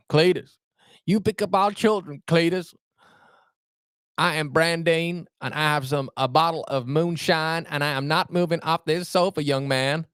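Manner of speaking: put-on southern accent. Streamer doing the southern accent a viewer asked for, hammed up high. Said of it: "Can you give us a southern accent?".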